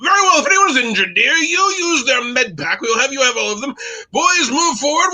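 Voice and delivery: high, commanding mock British officer accent; terrible British accent. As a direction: lecture the troops in plummy put-on British. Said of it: "I will do my terrible British accent".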